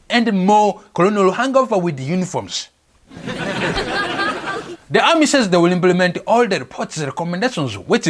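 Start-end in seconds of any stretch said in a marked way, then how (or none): 3.2-4.8 high laugh. Real, not acted. Burst of laughter.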